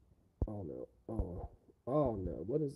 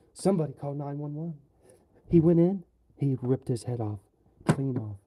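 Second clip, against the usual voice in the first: louder, hammed-up alarm; mock panic shout; 3 semitones higher, 9 dB louder than usual. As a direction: blurt it out like a panicked witness. Loud dramatic outburst.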